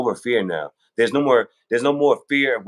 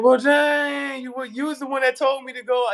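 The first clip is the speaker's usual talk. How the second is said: much higher, indignant mock whine; exasperated protest; 14 semitones higher than usual. Dead man protesting to the one who advised him, high indignant whine. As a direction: play the betrayed one protesting, voice pitched way up. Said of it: "And you be like".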